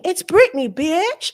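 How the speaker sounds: [name]. Acted. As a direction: try an American pop-star accent. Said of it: "I'm trying to do my American accent".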